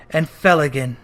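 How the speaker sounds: ordinary delivery, nothing marked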